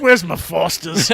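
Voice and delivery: slow, drawn-out bad Australian accent; mock Australian accent. Gamer joking about Australian beer, in a slow mock Australian accent. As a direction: ask for your beer like a cartoon Aussie. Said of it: "Your accent is terrible".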